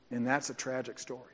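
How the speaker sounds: ordinary delivery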